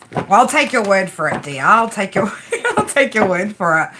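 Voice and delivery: ordinary talk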